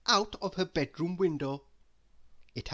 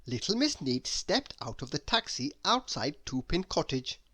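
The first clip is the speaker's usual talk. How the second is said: ordinary delivery, nothing marked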